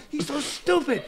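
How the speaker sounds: high, loud put-down; mock scornful butcher. Butcher mocking his neighbour, high and scornful. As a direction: sneer it like the butcher.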